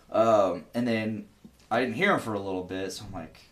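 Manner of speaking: normal talking voice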